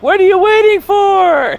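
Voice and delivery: high, loud, slow screamed line; horror movie scream. Retold or imitated. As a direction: scream it to the sky like a slasher heroine. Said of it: "like Jennifer Love Hewitt".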